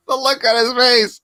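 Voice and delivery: normal talking voice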